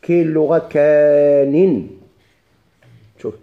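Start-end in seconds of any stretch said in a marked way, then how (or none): 0.6-1.9 "كان" drawn out slowly and loudly as a dialect form; "كان" as a demonstrated ka-in variant; variant pronunciation. Shown as a demonstration. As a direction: stretch "كان" into two slow syllables ending -in. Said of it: "بحذف المادة".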